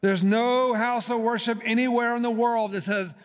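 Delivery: building rhetorical preaching; fervent preaching. Acted. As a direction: preach it rising toward the climax. Rising sermon climax.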